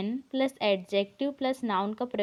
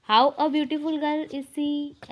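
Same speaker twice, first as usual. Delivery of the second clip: slow and a little higher; slow wrong example; 4 semitones higher, much slower than usual. Shown as a demonstration. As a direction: read the wrong order slowly. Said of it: "ऐसा sentence नहीं बनता". (गलत वाक्य थोड़े ऊँचे स्वर में)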